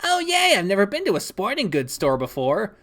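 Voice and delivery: high, eager squeal settling into chatter; delighted cheer. Acted. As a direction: gush about a first visit to the shop. Delighted about visiting the store.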